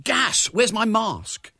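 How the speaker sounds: high, loud cry of alarm; alarmed boy raising the alert; genuine alarm. A narrator's reading. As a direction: cry out, drawing the words out in panic. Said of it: "cry out in genuine alarm".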